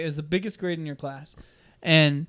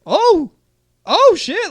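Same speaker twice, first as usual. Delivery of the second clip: high, loud startled gasp; startled relief; 13 dB louder, much slower than usual. Retold or imitated. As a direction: caught off guard, yelp it out. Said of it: "And I was like".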